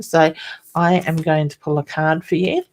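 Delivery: normal talking voice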